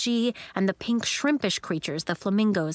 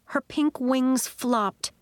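ordinary delivery, nothing marked

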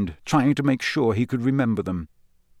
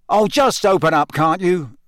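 angry; 6 semitones higher, 5 dB louder than usual. A narrator's reading. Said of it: "he said angrily".

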